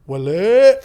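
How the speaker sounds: put-on loud called-out Wale, Lebanese accent; mock shout from inside. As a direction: shout Wale through the door like the mate. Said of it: "Just like that".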